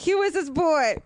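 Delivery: high, cooing pet-talk voice; talking to a cute dog in a very high coo. Real, not acted. Cooing over a dog photo.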